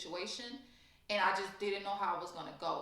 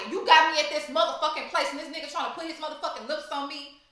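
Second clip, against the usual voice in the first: loud, high, fast indignant rant; a furious retold rant, sped up at the end; angry outburst; 6 semitones higher, 7 dB louder, faster than usual. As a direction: blow up in disbelief at your friend. Retelling her outburst at B.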